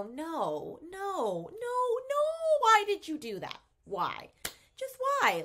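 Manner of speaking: high, pleading disbelief at the book; exasperated reader. Retold or imitated. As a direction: beg the character to explain herself. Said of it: "you're reading it and you go".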